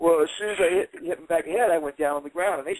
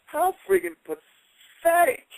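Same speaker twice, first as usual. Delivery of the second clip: slow, higher, scornful; contempt; 12 semitones higher, much slower than usual. Retold or imitated. Telling Knobs how pathetic he is, slow and scornful. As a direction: drag it out with disgust.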